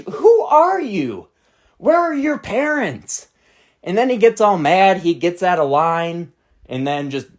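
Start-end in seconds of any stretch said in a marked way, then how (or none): 0.0-3.3 high, slow, incredulous; exasperated questions. Retold or imitated. Narrator refusing the kid, slow high disbelief. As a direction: stretch each word out in disbelief.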